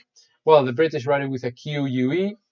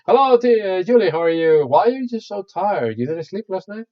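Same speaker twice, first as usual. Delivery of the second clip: higher-pitched example dialogue; acted concerned greeting; 7 semitones higher, 5 dB louder than usual. Teacher acting a chat with tired Julie, lifted greeting. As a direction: play out a chatty question to a friend.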